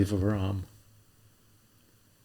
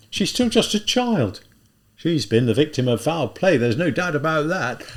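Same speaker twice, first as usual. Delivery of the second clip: put-on an older policeman clucking over the girl; policeman character; 6 semitones higher, 5 dB louder than usual. A policeman pitying the drunk girl, raised voice.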